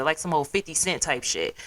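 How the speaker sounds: normal talking voice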